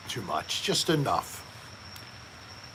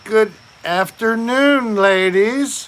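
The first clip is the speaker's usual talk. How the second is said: high, loud, slow hailing voice; joke punchline in character; 6 semitones higher, 5 dB louder, slower than usual. Acted. Blind man greeting at the fish market, hammed-up hail. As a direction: draw out the greeting loudly, pitched up. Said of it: "What did the blind man say".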